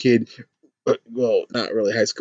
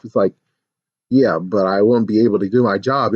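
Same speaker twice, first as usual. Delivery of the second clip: usual speaking voice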